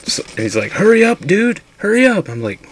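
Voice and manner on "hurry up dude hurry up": high, loud panicked shout; urgent yelled hurry-up; frantic yelling. Retold or imitated. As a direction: yell for your buddy to hurry. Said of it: "I'm hearing someone yelling my name".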